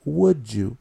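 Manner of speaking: "would you" blended into "wood-joo"; linked with j sound. Shown as a demonstration. Teacher asking learners to listen, "would you" as "wood-joo". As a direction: let the d of "would you" melt into a j. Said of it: "como una pequeña jotita en inglés".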